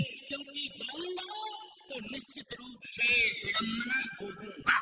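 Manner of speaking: very soft, as if thinking aloud; hushed inner thought. Acted. King reasons with himself about approaching Rahu, hushed. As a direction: drop right down, a private warning to oneself.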